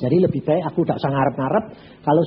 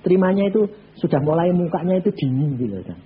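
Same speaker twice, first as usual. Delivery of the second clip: ordinary talk, nothing marked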